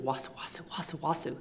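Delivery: soft whispered snake slither, very quiet; slithering hiss. A narrator's reading. Python slithering past the animals, whispered hiss. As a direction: breathe it out like a snake gliding past. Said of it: "who came slithering".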